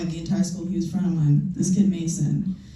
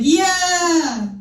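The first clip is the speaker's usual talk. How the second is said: eager cheer; 10 semitones higher than usual. Retold or imitated. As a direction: cheer along with naive excitement. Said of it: "I'd be like".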